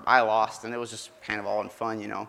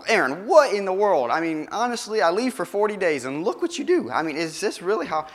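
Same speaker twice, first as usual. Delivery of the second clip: much higher, louder exasperated scolding; angry Moses; 8 semitones higher, 4 dB louder than usual. Acted. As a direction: scold your brother in disbelief. And he was mad.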